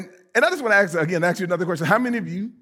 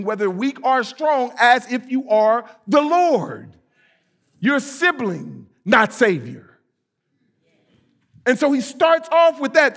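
preaching; 5 semitones higher than usual. Acted. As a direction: press each phrase from the pulpit.

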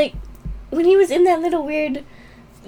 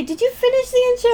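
quick question; 11 semitones higher, 4 dB louder, much faster than usual. Retold or imitated. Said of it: "I was about to say".

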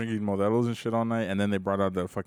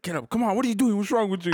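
high-pitched mock alarm; urgent retold scolding; 9 semitones higher than usual. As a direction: urge someone up in a rising panic, retold. Retells urging fallen drunk partner up.

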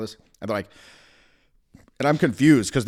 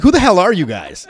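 high, loud, laughing demand; retold shout; 7 semitones higher, 12 dB louder than usual. Confronting undercover cops, loud laughing shout. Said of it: "I'm like".

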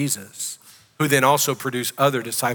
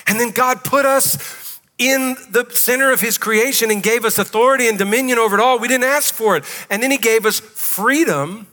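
raised, ringing pulpit voice, pitched higher; full-force preaching; 5 semitones higher than usual. Acted. Pastor preaching to the congregation about God's generosity, full-force preaching. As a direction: preach it out big to the whole room.

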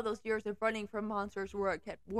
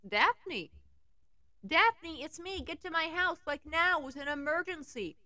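urgent; 7 semitones higher than usual. Acted. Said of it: "in my most urgent tone".

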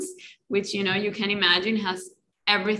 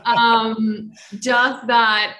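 laughing; 3 semitones higher, 8 dB louder, much slower than usual. Real, not acted. Laughs after talking over herself.

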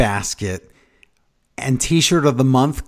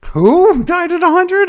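incredulous; 8 dB louder, slower than usual. Real, not acted. Co-host's confusing claim.

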